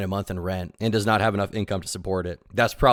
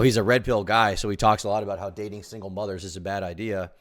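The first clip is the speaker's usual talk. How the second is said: normal talking voice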